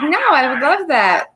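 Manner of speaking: high, swooping mock-eager voice; imitated eager reply. Imitating lawyer welcoming a review, mock-eager. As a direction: mimic him, pitched well up.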